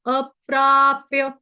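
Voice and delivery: अप्राप्य slow, with प्रा held long; slow, the प्रा drawn out long. Shown as a demonstration. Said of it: "प्रा जो है वो दीर्घ है".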